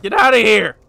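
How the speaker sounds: very loud, high yell; loud gamer outburst; incredulous shout. Real, not acted. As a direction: shout it out in exasperation. Yelling at the game.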